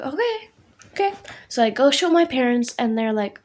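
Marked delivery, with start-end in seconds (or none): 0.0-1.1 high-pitched okay. Retold or imitated. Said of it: "And I'm like".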